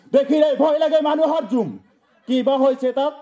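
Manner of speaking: high-pitched outburst, dropping at the end; dramatic exclamation. Acted. Poet reacting to a frightening crowd, high dramatic exclamation. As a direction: lift the whole line high in theatrical alarm.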